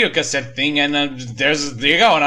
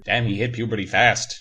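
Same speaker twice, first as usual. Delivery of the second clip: ordinary delivery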